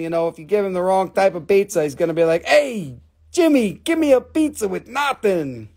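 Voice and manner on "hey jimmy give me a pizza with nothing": put-on dog with a pitched-up New York accent. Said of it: "He's got a New York accent".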